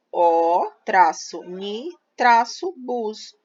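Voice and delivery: word split with a pause after each syllable. Shown as a demonstration. Teacher splitting ônibus into three syllables for pupils, syllable by syllable. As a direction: spell out ônibus as O, traço, ni, traço, bus. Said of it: "eu tenho três sílabas".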